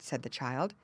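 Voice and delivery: plain reading voice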